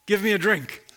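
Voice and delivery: normal talking voice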